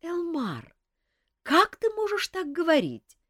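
high, indignant female voice; indignant young woman. A narrator's reading. Азиль возмущённо отчитывает Элмара, высоко и с негодованием. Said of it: "возмутилась Азиль".

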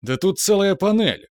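high, anguished protest; pained complaint. A narrator's reading. Kivrin complains about the panel, suffering. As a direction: wail over the panel he must leave. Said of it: "страдающий голос Киврина".

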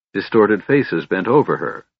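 ordinary delivery, nothing marked

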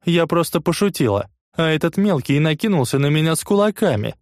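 put-on high whining girl; whiny nasal complaint. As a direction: complain through a blocked nose. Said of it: "жалобно гундосит девчонка". (жалобно гундосит)

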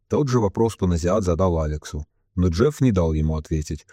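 plain reading voice

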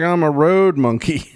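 slow, high goofy monkey voice; road monkey voice. Acted. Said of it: "He's like".

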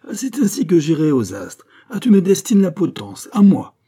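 high, loud exasperated outburst; acted fury at full voice; vociferating. A narrator's reading. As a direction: cry out in outraged disbelief. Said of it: "vociféra ce dernier".

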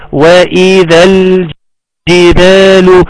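slow, drawn-out tajweed recitation; Quran recitation. Shown as a demonstration. Teacher presenting the next verse, slow recitation. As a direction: recite with each long vowel held.

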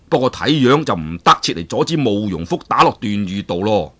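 usual speaking voice

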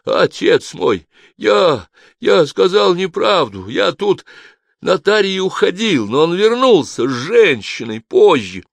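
high, loud, pleading; frightened wail of a cornered man; panicked confession. A narrator's reading. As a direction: confess in desperate haste to be believed. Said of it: "будто он сейчас разрыдается".

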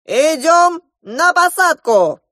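high, loud, drawn-out announcement; proclaiming. A narrator's reading. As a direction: call out slowly to all on board. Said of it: "возвестил гусь".

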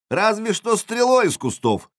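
high, mocking call back; shouted retort. A narrator's reading. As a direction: throw the jeer across at the enemy. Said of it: "крикнул я в ответ".

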